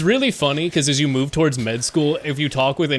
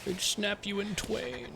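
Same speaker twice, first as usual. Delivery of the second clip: put-on old man voice; 7 semitones higher, 12 dB quieter than usual. Streamer jokes about school back in his day, old man voice. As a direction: put on a soft, high-pitched old man. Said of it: "That old man voice you do".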